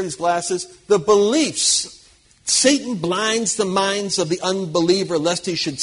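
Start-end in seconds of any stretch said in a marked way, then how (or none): 0.9-2.1 very slow, louder, each word weighed; emphatic. Acted. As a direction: drive the point home slowly. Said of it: "see these lenses here".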